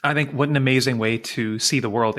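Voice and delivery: ordinary delivery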